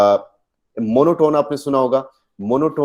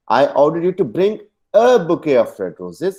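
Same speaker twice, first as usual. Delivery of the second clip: "a" drawn out and lifted, slow sentence; stress on A; 4 semitones higher, much slower than usual. Shown as a demonstration. Teacher shows it was one bouquet, stress on a. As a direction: set off the word "a" with emphasis. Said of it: "एक bouquet लेना था".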